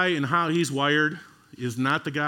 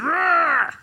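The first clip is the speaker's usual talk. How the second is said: high, loud exasperated growl; frustrated outburst; 10 semitones higher, 5 dB louder than usual. Retold or imitated. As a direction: blow up like a fed-up leader. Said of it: "He's like".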